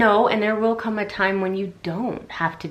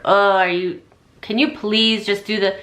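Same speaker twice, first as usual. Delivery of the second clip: louder, exasperated nagging request; impatient demanding tone; 2 semitones higher, 6 dB louder than usual. Shown as a demonstration. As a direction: snap the request at him with irritation. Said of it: "are you asking it that way".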